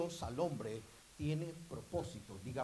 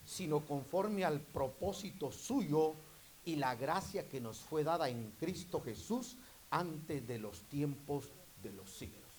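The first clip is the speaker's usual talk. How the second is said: preaching; 5 dB louder than usual. Acted. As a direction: preach the verse, leaning on each word. Preacher reads scripture emphatically. (lectura bíblica predicada, pausada y enfática)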